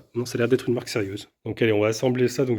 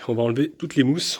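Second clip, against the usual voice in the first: normal talking voice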